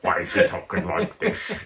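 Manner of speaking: low, weird mock Scottish-Bond voice; Sean Connery. Fan puzzled by Connery's Bond, imitating his weird accent. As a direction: drop low into a jokey odd accent. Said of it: "He talks weird".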